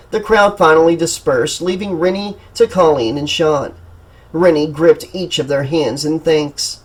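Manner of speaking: plain reading voice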